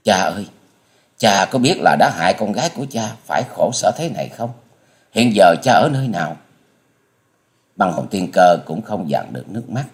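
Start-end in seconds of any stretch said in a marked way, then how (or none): none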